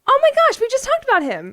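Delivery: very high, loud excited disbelief; astonished. Real, not acted. Co-host reacts to a climber they just talked about, astonished. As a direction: gush in amazement at the coincidence. Amazed at the coincidence.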